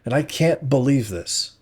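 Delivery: fed-up angry shout; angry disbelief. A narrator's reading. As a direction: let the outrage burst. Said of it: "I get stuck into him".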